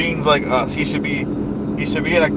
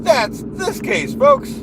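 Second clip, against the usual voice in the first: yelling; 12 semitones higher, slower than usual. Acted. Ranting crescendo.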